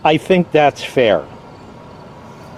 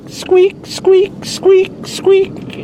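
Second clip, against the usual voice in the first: high squeaking imitation of a car belt; high-pitched mock squeak noises; squeaky belt imitation; 13 semitones higher, much slower than usual.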